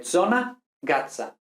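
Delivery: zona and gaza with a ts. Shown as a demonstration. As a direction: bite the Z into a ts. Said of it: "sino como Z".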